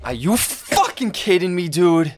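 high, loud incredulous cry; outraged disbelief. Real, not acted. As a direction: scream your disbelief at the screen. Disbelief at the execution.

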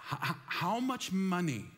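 stuttering, amazed at the sum; a listener stunned by the question, stammering; stammering mock shock. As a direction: trip over the words in disbelief. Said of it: "Some of you are like".

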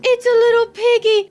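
high, loud delighted squeal; excited squeal. Real, not acted. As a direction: squeal at a cute piglet. Hatches a pig, squeals.

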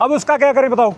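high, heated shouting at a driver; real angry roadside argument; angry yelling. Real, not acted. Roadside quarrel with truck driver.

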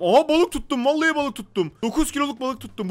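loud, high shouts of joy; crowing over a big catch; excited. Real, not acted. Gamer shouting at the fish he caught, thrilled.

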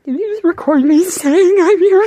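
high, loud outraged question. Acted. Tony catching Peter recording him, high loud outrage. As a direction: let it fly up in outrage.

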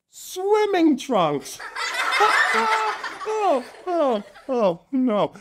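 high, slow punchline dissolving into giggles; giggly punchline. Acted. Host delivers elephant pun to kids, giggling high. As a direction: drag out the pun then crack up.

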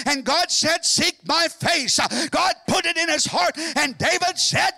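loud, high, ringing sermon voice; fervent revival preaching; full-force preaching. Acted. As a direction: preach it out to the whole church.

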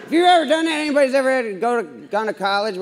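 ordinary talk

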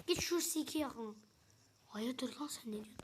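hushed unvoiced whisper; whispering while hiding. Real, not acted. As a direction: whisper so the monster can't hear. Hiding from monster in game.

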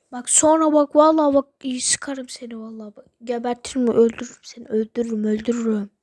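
furious ranting threats; angry yelling. Real, not acted. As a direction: shout him down, furious.

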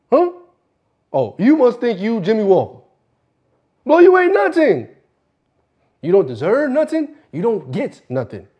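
high, loud put-down; angry berating. Acted. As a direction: throw the insult out high and hard.

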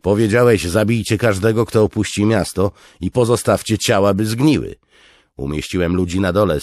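high, loud, insistent voice; forceful officer. Acted. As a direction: press the point urgently.

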